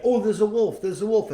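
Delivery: high, loud alarmed cry; panicked boy raising the alarm; shouting wolf. Retold or imitated. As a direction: yell the warning to the village.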